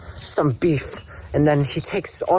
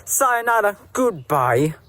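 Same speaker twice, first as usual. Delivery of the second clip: cheeky goodbye; 7 semitones higher than usual. Acted. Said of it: "Dylan says".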